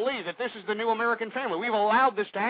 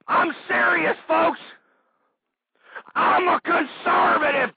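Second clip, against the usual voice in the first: real angry shout; 6 semitones higher, 4 dB louder than usual. Real, not acted. Talk host yelling at liberals, real angry shout. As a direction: shout it out, fists on the desk. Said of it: "I know I'm getting a little upset here".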